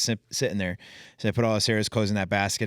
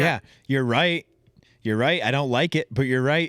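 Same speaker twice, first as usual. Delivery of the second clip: higher and louder, mock reluctant admission; grudging concession; 5 semitones higher, 4 dB louder than usual. Host jokes along as the corrected father, grudging and amused. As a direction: concede it like you hate to.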